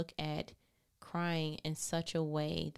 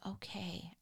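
whispered okay; 10 dB quieter than usual. Retold or imitated. Accepting God's correction in retold talk.

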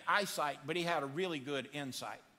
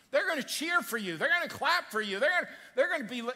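ordinary delivery

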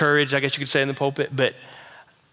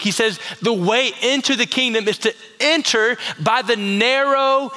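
preaching at full force; 6 semitones higher than usual. Acted. Pastor urging the congregation, fervent preaching. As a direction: drive the point home to the whole church.

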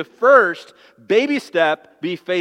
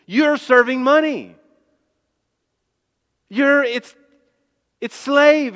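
higher and louder, driving each point home; fiery preaching; 6 semitones higher, 4 dB louder than usual. Acted. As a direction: preach it hard at the congregation. Preaching at full force.

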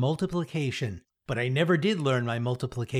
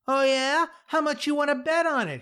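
taunting challenge; 13 semitones higher, 4 dB louder than usual. A narrator's reading. Kid's challenge in story dare.

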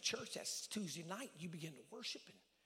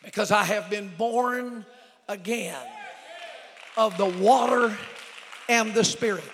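loud, high, drawn-out sermon shouting; full-force preaching; 8 semitones higher, 25 dB louder, slower than usual. Real, not acted. Preacher telling congregation sins are forgotten, full-force shouting. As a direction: build to a shouted proclamation, word by weighted word.

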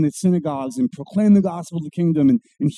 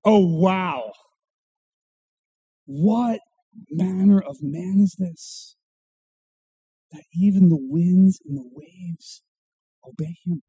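hushed wonder; slower than usual. Retold or imitated. Disciples marvelling at Jesus, slow hushed amazement. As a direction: marvel under your breath. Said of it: "They go".

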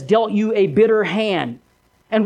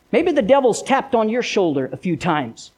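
normal talking voice

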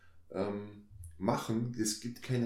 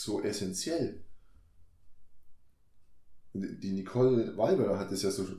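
ordinary talk

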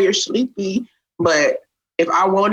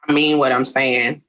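ordinary delivery

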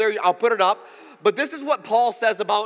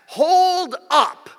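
high loud hold up; called out; 7 semitones higher, 6 dB louder than usual. Shown as a demonstration. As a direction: proclaim it to the room.

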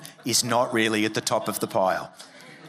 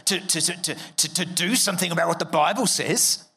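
higher, whiny objecting voice with stammering; mocking a critic; 7 semitones higher than usual. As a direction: parody the worried critic, stumbling over "to". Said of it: "some people have said to me".

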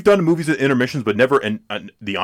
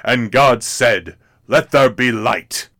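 very slow, louder booming narrator; Cecil B. DeMille narration; 5 dB louder, slower than usual. Retold or imitated. The director narrates creation, booming slow and grand. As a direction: intone it like the voice of God. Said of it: "I wish I could sound like him".

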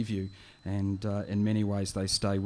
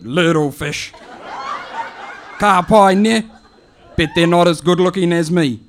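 put-on high, loud calling out to the boy; an old auntie hollering at an answer phone; high loud auntie voice shouting for her nephew; 8 semitones higher, 15 dB louder than usual. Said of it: "it goes like this".

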